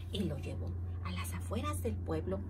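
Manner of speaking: usual speaking voice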